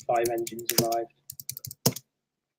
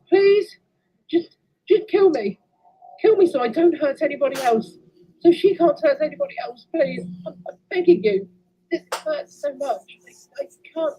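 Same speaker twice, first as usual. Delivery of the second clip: tearful begging; 11 semitones higher than usual. Acted. Begging to be killed, crying.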